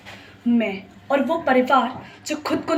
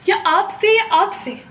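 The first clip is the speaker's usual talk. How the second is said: raised, louder, higher-pitched demand; pointed challenge; 7 semitones higher, 5 dB louder than usual. Acted. Lawyer confronting people who laughed in court, pointed challenge. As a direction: press each listener in turn, pitch lifted.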